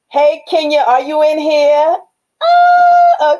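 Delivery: high, loud call across the house. Real, not acted. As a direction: call out to Kenya in the next room. Calling out to Kenya.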